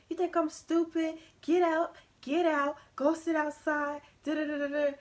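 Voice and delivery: hammed-up mother shouting, get out; imitated angry mom. Mom throwing her daughter out of the house, mock angry yell.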